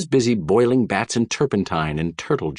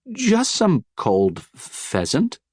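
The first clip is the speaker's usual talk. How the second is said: high, faltering caught-out reply; nervous stammer; 8 semitones higher, slower than usual. A narrator's reading. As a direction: stumble over the word in fright.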